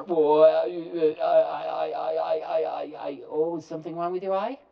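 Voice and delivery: loud stammering, high and flustered; stammering caller. Retold or imitated. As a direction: stammer it out, caught off guard. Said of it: "And stammer".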